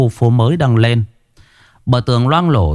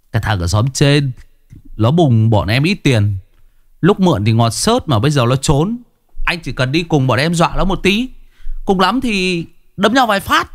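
put-on higher, swaggering young thug; cocky thug, higher voice; thug Phấn; 6 semitones higher than usual. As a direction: coax him in like a cocky street punk. Phấn talks them into it.

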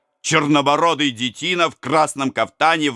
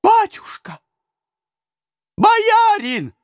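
very high, loud pleading wail; high desperate cry; wailing peasants; 4 dB louder, slower than usual. A narrator's reading. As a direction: wail for the lord's help. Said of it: "вопили те".

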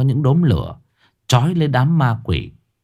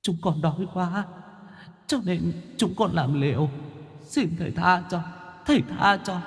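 much softer, higher, breathless ghostly begging; barely a thread of voice, pleading; feeble wheezing; 8 semitones higher, 9 dB quieter than usual. Acted. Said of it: "thều thào trả lời". (giọng thều thào yếu ớt của ma đói van xin)

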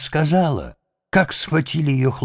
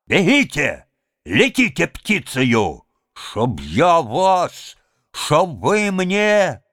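furious orders; 6 semitones higher, slower than usual. A narrator's reading. Head orders men away.

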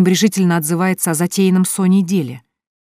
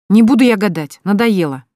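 higher, louder angry refusal; 5 semitones higher, 7 dB louder than usual. A narrator's reading. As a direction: snap it out, fed up. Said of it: "рассердилась Соня".

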